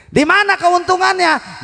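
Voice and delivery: high-pitched ringing question; preacher's soaring call-out; rhetorical call. Real, not acted. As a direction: throw the question out high to the crowd.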